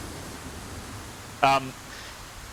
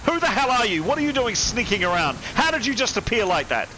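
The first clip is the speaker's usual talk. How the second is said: panicked crewman shouting at the intruder; furious scared sailor; 9 semitones higher than usual. Acted. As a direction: demand answers from the stranger in fear.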